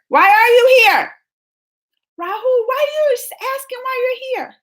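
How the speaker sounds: very high, incredulous mock outrage; mock indignation. Vlogger challenging viewer Rahul, playfully indignant. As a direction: squeal the question in playful disbelief.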